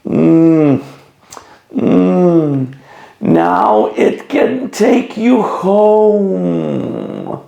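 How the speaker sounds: pained, whimpering toothache reading. Shown as a demonstration. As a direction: talk as if your tooth hurts. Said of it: "read like you have a toothache".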